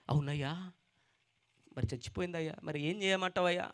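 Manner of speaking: soft, hopeless plea; a helpless father asking; grieving father. Acted. Grieving father answers Jesus.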